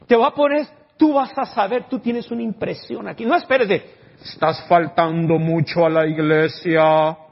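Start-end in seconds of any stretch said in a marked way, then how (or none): 4.2-7.2 slow and louder pretend heavenly rebuke; mock booming divine voice. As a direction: intone it like a scolding voice from above. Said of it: "No, no esperes esa voz".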